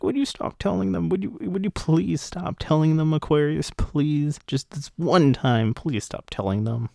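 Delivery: mock freak-out, high pleading; Lucy. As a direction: imitate a flustered, embarrassed plea. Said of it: "Lucy is, like, freaking out".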